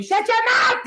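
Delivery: shrill, high, loud mock scream; retold scream. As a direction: shriek it like the furious woman did. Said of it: "And she fucking screaming".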